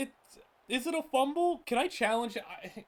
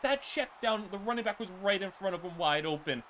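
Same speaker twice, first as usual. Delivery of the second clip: ordinary talk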